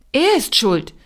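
indignant farmer defending himself; defensive. A narrator's reading. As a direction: shift the blame, defending yourself. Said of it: "verteidigte sich der Bauer".

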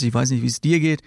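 normal talking voice

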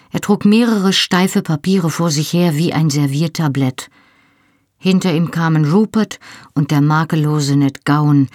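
ordinary delivery

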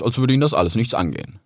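ordinary delivery, nothing marked

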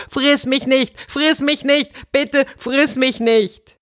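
hoch und hysterisch flehend. A narrator's reading. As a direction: tritt den Löwen und fleht immer wieder panisch. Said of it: "Da wurde der Mann hysterisch". (hysterisch und hoch, beim Treten des Löwen)

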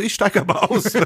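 high, worked-up quote without shouting; retold exasperation. Handing the talking to his friend, high retold exasperation. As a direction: imitate yourself arguing, voice pitched right up.

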